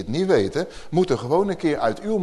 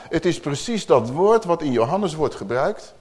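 ordinary talk

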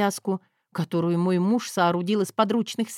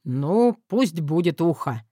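amused; 3 semitones lower, slower than usual. Acted. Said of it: "хмыкнул мой рыцарь".

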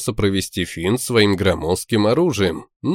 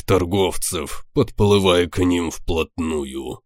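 through gritted teeth; 2 semitones lower than usual. Acted. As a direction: squeeze words out through clenched teeth. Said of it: "процедил он".

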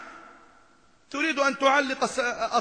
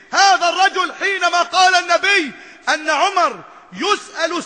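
loud, high impassioned sermon voice; fervent preaching; 6 semitones higher, 8 dB louder than usual. Acted. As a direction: preach it at full force to the crowd. Preacher at full force. (خطبة حماسية بصوت عال)

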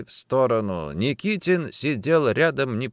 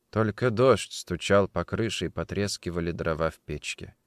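usual speaking voice

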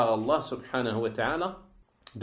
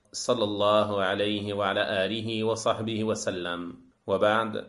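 rapid, level-pitched Arabic recitation; Arabic sermon opening; 2 semitones lower, faster than usual. Acted. Lecturer opening a religious lesson with Arabic praise, formal recitation.

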